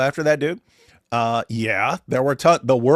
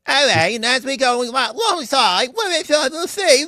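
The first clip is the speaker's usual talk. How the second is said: high-pitched mock Russell Brand British accent; 12 semitones higher, 5 dB louder than usual. Mocking a comedian's stupidest accent to his co-host, hammed-up British accent. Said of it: "He's got the stupidest accent of anyone ever".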